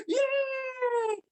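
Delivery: high mock giggle into a long squeal; laughing child's cheer. Children laughing through turbulence, gleeful squeal. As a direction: imitate kids giggling and squealing yay. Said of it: "Ketawa-ketawa".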